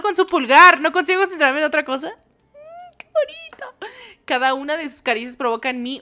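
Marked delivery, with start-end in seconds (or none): 2.1-3.9 much higher, soft dreamy sigh; mock swoon. Streamer swooning over the hand caress, high dreamy sigh. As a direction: melt into it like a lovestruck girl.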